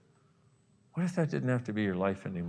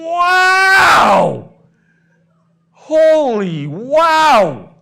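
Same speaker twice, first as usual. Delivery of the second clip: much higher, louder, drawn-out cry of wonder; ecstatic shout; 17 dB louder, much slower than usual. Pretend. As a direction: belt out sheer amazement at the water. Ecstatic in the imagined shower.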